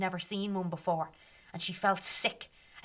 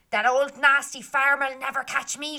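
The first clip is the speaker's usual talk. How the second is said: higher, louder, a bold girl mocking the farmer; cheeky taunt; 6 semitones higher, 8 dB louder than usual. Runaway girl taunting the farmer, cheeky and loud. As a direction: toss it back over her shoulder. Meg taunting while running away.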